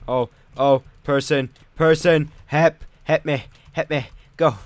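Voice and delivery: loud, high panicked calls; panicked yelling. Real, not acted. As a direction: yell for help in a panic. Panicked calls during attack.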